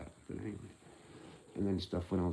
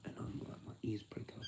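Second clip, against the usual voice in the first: hushed, unvoiced confiding; whispering; 9 dB quieter than usual. Real, not acted. Telling viewers about overhearing English, in a whisper. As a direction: whisper it like a secret.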